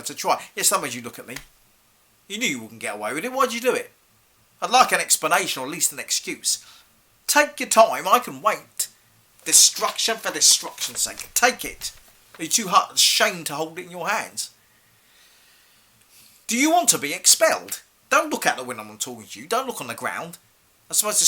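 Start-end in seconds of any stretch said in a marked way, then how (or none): 3.3-3.9 exasperated demand. Acted. Teacher demands a reason. 7.3-17.8 raised, higher voice with forceful stresses; loud teacherly scolding; angry challenge. Acted. Teacher challenges pupil to take book.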